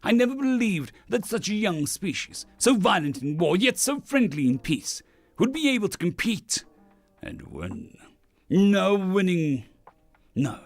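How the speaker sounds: declaimed first-person tale in a lofty character voice; proud alien drinking champion. Acted. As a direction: boast like a galactic champion telling his tale.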